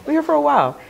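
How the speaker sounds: eager, jittery reply to the officer; nervous eager. Retold or imitated. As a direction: rush it out to sound friendly. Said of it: "And I said".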